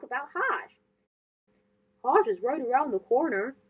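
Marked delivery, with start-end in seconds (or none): 2.0-3.6 parent voice. Acted.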